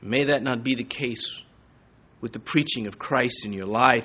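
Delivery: ordinary delivery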